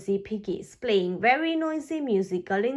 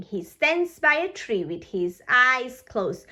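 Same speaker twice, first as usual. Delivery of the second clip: usual speaking voice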